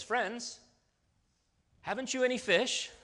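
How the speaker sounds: high, loud mock English accent. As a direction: call it out cheekily in an English accent. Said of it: "it's in an English accent".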